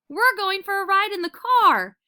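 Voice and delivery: high and loud, thrilled announcement; girl telling her dog about a car ride; excited. A narrator's reading. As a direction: shout the good news to the dog.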